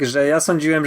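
ordinary talk

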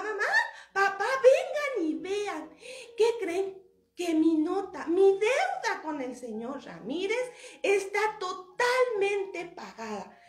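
high, loud, drawn-out proclamation; excited announcement. Acted. Toñito telling his parents the debt is paid, loud and excited. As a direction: summon everyone and slowly proclaim the big news.

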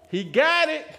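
high, loud proclamation; joyful shout. Retold or imitated. Church mother announcing the breakthrough, joyful shout. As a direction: shout the good news out.

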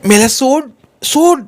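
rising protest, climbing on the last सोड; startled shout. A narrator's reading. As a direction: shriek at him to let her go. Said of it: "तशी ती ओरडली".

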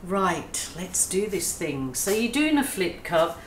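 ordinary talk, nothing marked

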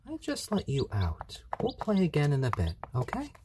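high sing-song pet voice. Real, not acted. High coo to the chicken Kagome.

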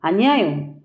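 ordinary delivery

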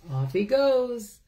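plain reading voice

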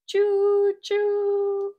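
high loud choo choo whistle; train whistle cheer. Acted. Papa train cheering his son with a loud whistle. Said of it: "Papa Pufferbelly cheered".

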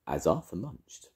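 ordinary delivery

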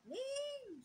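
high calling shout across the house. Retold or imitated. Frightened aunt calling her niece from the bathroom, high shout. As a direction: call her name loud and high.

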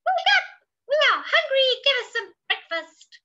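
very high, loud monkey squeal; squeaky, clamouring monkey voices; crying monkeys. Acted. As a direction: chatter it out high and excited. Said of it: "cried the monkeys".

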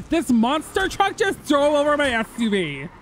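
high, panicked caller voice; Anita, 911 caller. Acted. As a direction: play a frantic caller phoning police. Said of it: "I can be Anita and just be like".

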